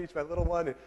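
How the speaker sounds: ordinary talk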